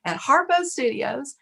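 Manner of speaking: rally the whole line behind you; announcing to a crowd. Retold or imitated. Announcing her Oprah tape to the post office crowd, excited and high. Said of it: "And I said".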